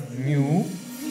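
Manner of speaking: म्युझिकल drawn out slowly with a rise; teacher repeating म्युझिकल slowly; slow model word. Shown as a demonstration. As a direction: hold each part of म्युझिकल. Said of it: "म्हणजे शब्द काय झाला".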